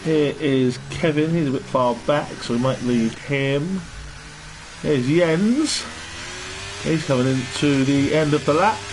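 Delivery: game commentator. Acted. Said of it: "Why do I sound like a game commentator?".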